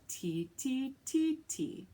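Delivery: four quick even ti beats; rhythm syllables. Shown as a demonstration. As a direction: tap out ti ti ti ti. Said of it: "to represent the four syllables".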